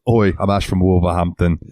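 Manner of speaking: a second light British imitation of "Oi, I'm Ash from Wolverhampton"; mock British "Oi" with the w of "Wolverhampton" kept; British accent. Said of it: "said it so British".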